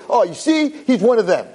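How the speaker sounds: high sneering imitation of a heckler; mock the man trying to provoke; mocking taunt. Mimicking Scott's smug taunt to the rabbi.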